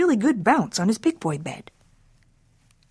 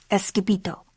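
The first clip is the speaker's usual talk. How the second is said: ordinary delivery, nothing marked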